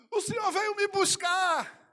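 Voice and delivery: high, loud, slow insistent cry; shouting up at Jesus in hope; pleading joyful shout. Retold or imitated. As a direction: insist to Jesus he came to fetch you. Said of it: "eu dizia com alegria tão grande".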